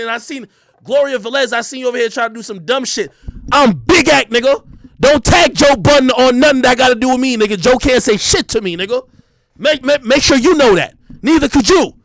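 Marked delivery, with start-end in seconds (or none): 3.5-11.9 loud, heated callout; loud rant. Real, not acted. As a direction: bark it straight at the camera. Angry rant at critics online.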